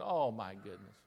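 low, soft sinking realization; hushed dismay. Retold or imitated. Pastor realizes the checks will bounce, hushed dismay. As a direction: let it drop away as a private thought.

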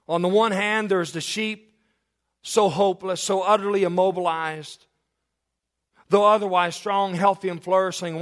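preacher in full flow; fervent preaching. Acted. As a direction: lift the congregation with it.

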